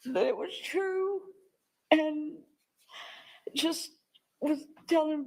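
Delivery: high-pitched, tear-choked account; real crying. Real, not acted. Witness recalling the family hearing of the death, crying. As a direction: keep talking through the sobs.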